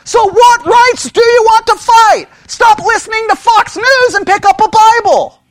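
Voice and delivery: high, loud preaching; forceful rebuke. Real, not acted.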